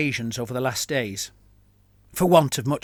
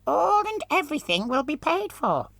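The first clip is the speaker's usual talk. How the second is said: very high, whining voice through a mask; whining muffled voice. Acted. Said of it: "in a whining voice muffled by his mask".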